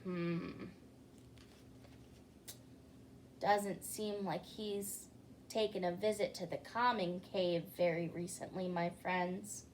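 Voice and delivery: quiet aside. Acted.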